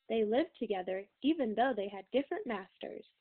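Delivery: plain reading voice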